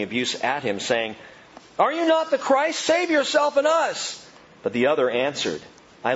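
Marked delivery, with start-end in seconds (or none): 1.8-4.2 mocking criminal. Criminal hurling abuse at Jesus.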